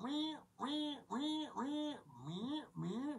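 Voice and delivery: high, soft squealing "wee"; imitated phone noise. Vlogger mimics his phone failing, high squeaky noise. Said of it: "the phone just went".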